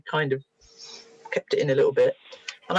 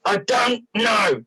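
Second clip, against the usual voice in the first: slow, drawn-out shout, word by word; stretched, emphatic DON'T KNOW; retold exasperated child; 3 semitones higher, much slower than usual. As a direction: imitate a fed-up boy insisting. Boy's later answer, pressed again.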